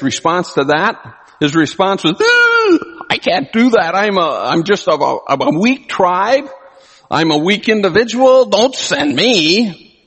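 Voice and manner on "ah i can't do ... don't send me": high, whining plea to be let off; Don't make me, I'm nobody; whiny refusal. Retold or imitated. Preacher voicing Gideon begging the angel not to send him, whiny and high.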